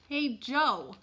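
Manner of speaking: loud, high call to a colleague. A narrator's reading. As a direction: holler to the driver. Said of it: "the attendant called to the driver".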